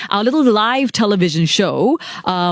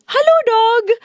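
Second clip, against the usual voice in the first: cheery greeting to a dog. Retold or imitated. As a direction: greet the dog like a delighted child. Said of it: "I go".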